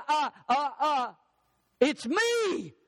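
high, slow, stammering mock panic; hammed-up terrified little boy; stage fright. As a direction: stutter it out like a scared kid frozen on stage.

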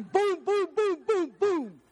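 high punchy boom boom sounds; playful imitation of gunfire; gunshot booms. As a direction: fire off each boom like a Magnum shot.

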